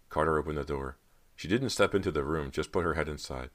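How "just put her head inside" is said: plain reading voice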